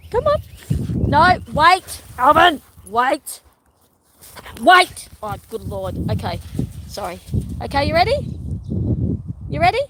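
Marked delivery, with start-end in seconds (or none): 0.1-5.1 high, loud urgent call. Real, not acted. Stopping the dog. 7.6-9.8 excited to the dog. Real, not acted. Getting dogs excited.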